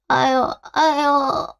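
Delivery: slow, tearful moaning sigh; a weary, weeping groan; crying sigh. Acted. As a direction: let it drag out slowly through tears. Sick mother alone, in pain.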